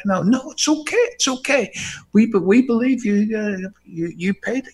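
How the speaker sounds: reassuring conductor. Retold or imitated.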